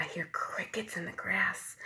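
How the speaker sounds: hushed whisper. A narrator's reading. Teacher reading the quiet park scene, whispering.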